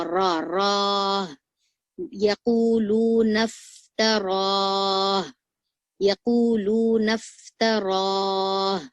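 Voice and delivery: यकूलून इफ्तरा very slow, heavy र, drawn-out end; slow, loud tajweed drill of इफ्तरा; clear heavy r recitation. Shown as a demonstration. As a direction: draw out इफ्तरा with a thick र. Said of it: "हा की आवाज अच्छे से pronounce करेंगे".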